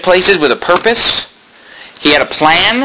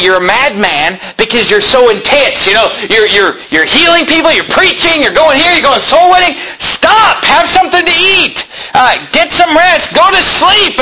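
disciples scolding; 9 semitones higher than usual. Retold or imitated. Disciples telling Jesus to stop and rest, building to a high shout.

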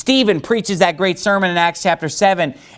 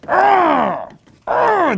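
snarling through gritted teeth; angry teeth growl; 14 semitones higher than usual. Shown as a demonstration. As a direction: growl like a furious man. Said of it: "gritting their teeth at you".

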